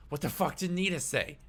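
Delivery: high, worried acted interrogation; alarmed questioning. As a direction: pitch jumping high in alarm. Panicked character interrogates the player.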